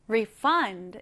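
refund said re-FUND, weight at the end; stress on second syllable. Shown as a demonstration. Teacher repeats refund as a verb for learners, stressed on FUND. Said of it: "강세가 뒤에 들어갑니다".

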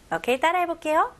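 usual speaking voice

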